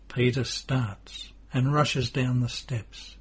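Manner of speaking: ordinary delivery, nothing marked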